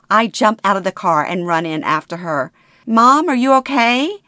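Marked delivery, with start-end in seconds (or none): 2.8-4.2 higher, louder anxious call; worried. Acted. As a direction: call out in relief and worry. Daughter rushes in after mother.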